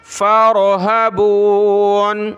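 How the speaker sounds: फ़रहबून drawn out syllable by syllable; slow syllabled recitation. Shown as a demonstration. Teacher demonstrates the word slowly for students. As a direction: stretch फ़रहबून slowly, every syllable clear. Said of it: "फ़ा रा ज़बर फ़ारो".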